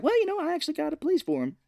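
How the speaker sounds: high, fast, panicky quote; nervous backpedal. Retold or imitated. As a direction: scramble to appease the thugs. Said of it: "he's like".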